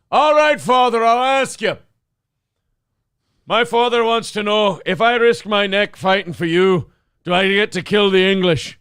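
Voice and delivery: lightly comic Irish movie voice; Irish accent. Acted. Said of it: "slipping into Irish for Braveheart".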